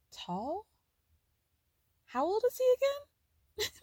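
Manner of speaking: incredulous, rising sharply high on the second question; mock baffled, slow and very high; puzzled disbelief. Kris to Paris about her baby, baffled and high-pitched. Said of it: "Chris looks at her and goes".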